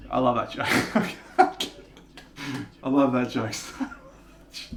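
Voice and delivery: giggling through the words; real laughter breaking in; laughing. Real, not acted. Laughing at own joke.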